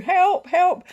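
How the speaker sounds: high, loud cry for help; re-enacted shout for rescue; retold shout. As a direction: call out as if stuck and desperate. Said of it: "of course I'm shouting".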